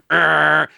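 loud growling truck blare; imitated truck horn. Trucker right up on your tail, loud imitated horn blare. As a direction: blast it like a big rig behind you. Imitates eighteen-wheeler bearing down.

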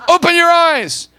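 slow, high, very loud order; insistent shouted command. Acted. God insisting to a reluctant believer, shouted command. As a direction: drag out each word in a shout.

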